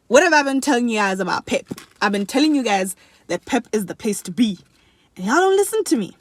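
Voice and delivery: loud, exasperated I-told-you-so; told-you-so rant; mock scolding. Real, not acted. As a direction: scold them playfully, pitch leaping up at the end. Mock scolding viewers about Pep.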